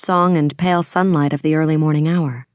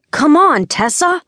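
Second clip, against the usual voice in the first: much higher, louder exasperated cry; exasperated protest; 12 semitones higher, 5 dB louder than usual. Acted. Brother protests his sister's accusation, exasperated cry. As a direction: whine her name in protest, pitch climbing.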